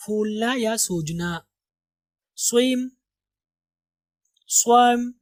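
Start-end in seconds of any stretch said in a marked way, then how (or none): 4.5-5.1 "स्वम" with the flat 'a' of cat; सही उच्चारण. Shown as a demonstration. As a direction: say "स्वम" as SWAEM. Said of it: "not swam". ("स्वम" में 'ऐ' जैसी आवाज़)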